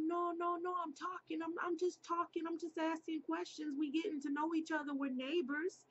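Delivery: high-pitched, hurried protest; insistent backpedaling. Retold or imitated. Neighbor tells girl he's just talking, insistent. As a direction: imitate someone talking fast and high to smooth things over. Man insisting he means no harm.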